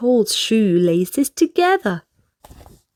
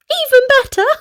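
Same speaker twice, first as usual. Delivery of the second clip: much higher, gleeful squeal; 13 semitones higher, 4 dB louder, much faster than usual. A narrator's reading. Miss Naughty delighting in the bath idea, gleeful squeal. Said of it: "laughed Miss Naughty".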